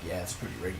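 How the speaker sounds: low, quiet impression of a strange man; creepy stranger. Nosy stranger cutting in behind the family, low creepy mutter. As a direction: mimic a man butting in, low and under the breath. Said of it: "And he's like".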